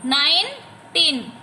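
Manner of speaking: "Nineteen" slowly, gap after nine; teacher sounding out "nineteen" in separate parts; syllable split. Shown as a demonstration. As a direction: break "nineteen" into nine and टीन. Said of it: "बोल के repeat करके देखना है".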